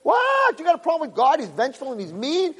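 high-pitched, acted disbelief, starting on a shout; incredulous challenge. Rabbi confronting a girl about God, incredulous challenge. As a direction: shoot up high in mock outrage, then ease off.

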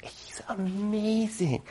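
slow, whispered "amazing"; dreamy whisper. Retold or imitated. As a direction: breathe it like she's in love. New wife dreamily praises husband.

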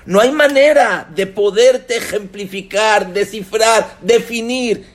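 high, loud, emphatic refusal; hurt outburst. Acted. Hurt woman telling him she cannot forgive, impassioned outburst. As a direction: pour out the old hurt at full voice.

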